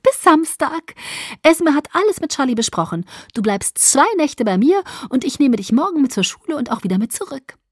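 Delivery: put-on high, loud, bubbly girl voice; chirpy Alice. A narrator's reading. Alice erklärt Bella fröhlich den Plan, hoch und laut. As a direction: chirp it out brightly.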